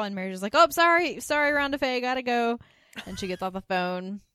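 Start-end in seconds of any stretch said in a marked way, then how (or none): 0.6-2.6 a higher, louder mock flustered brush-off; hurried goodbye. Mary Jo cutting off the caller, in a mock flustered rush.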